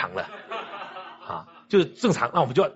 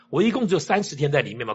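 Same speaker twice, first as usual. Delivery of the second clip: usual speaking voice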